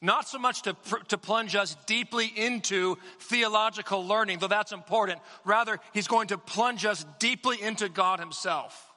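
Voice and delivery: rising fervent sermon voice; impassioned preaching. Acted. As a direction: preach it with mounting fervor.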